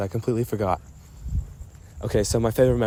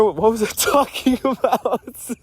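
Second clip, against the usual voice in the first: high, slow, laughing through the words; laughing exasperation; 12 semitones higher, 4 dB louder, slower than usual. Real, not acted. Talking to Andrew, laughing at forgetting again. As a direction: giggle helplessly at losing the thread.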